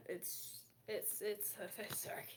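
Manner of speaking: whispered, barely voiced; hushed whisper. Real, not acted. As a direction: whisper it as if the dark is close. Whispering about the dark.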